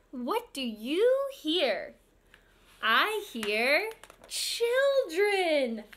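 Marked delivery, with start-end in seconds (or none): 2.8-5.8 slow, higher and louder reveal; excited reveal. Acted. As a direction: build up the surprise.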